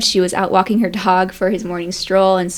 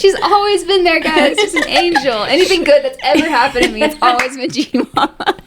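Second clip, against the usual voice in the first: gushing excitedly; 7 semitones higher, 3 dB louder than usual. Real, not acted. Gushing about G Mama, laughing.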